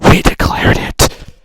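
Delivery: slow, full-force yell; huge drawn-out declaration; explosive shout. Acted. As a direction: blast out each word as the punchline. Shouted punchline of acted Americans.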